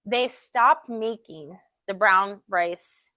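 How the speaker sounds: normal talking voice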